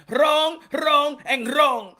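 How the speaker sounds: each wrong hit hard and slow; emphatic wrong. Retold or imitated. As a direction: slam down every wrong, slowly. Rejecting a question emphatically.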